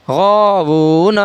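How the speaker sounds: slow "غاوون" with long aa and oo, short final na; drawn-out recitation. Shown as a demonstration. Quran teacher reading out the word, "غاوون" slowly drawn out. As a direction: stretch both long vowels of "غاوون". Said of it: "الف مدہ کو ایک الف کے برابر کھینچ کر پڑھیں".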